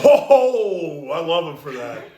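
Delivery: said through a laugh; laughing fond praise; laughing fondly. Real, not acted. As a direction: chuckle it out fondly. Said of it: "laughing affection".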